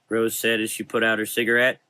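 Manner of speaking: plain reading voice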